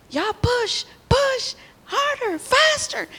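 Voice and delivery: put-on high, loud bossy child's yell; hammed-up kid ordering friends to push harder; childish shout. Little girl yelling at her friends to push harder, high loud shout. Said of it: "And I said".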